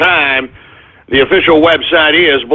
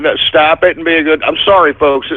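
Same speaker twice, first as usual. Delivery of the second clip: ordinary talk, nothing marked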